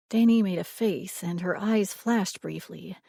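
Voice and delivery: ordinary delivery, nothing marked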